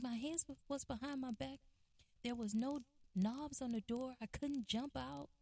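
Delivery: retold whiny grievance; mock tearful complaint. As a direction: complain like an upset brother, acted lightly. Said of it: "he just kinda sobbed and cried".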